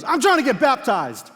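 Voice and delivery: high, loud indignant yell; furious teen yelling at a teammate; angry shout. Retold or imitated. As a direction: shout it back at him.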